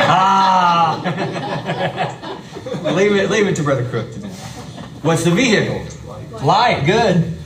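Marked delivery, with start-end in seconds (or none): 0.0-4.3 laughing. Real, not acted. Class laughs at a joke answer.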